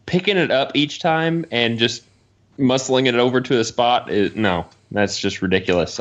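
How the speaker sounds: ordinary delivery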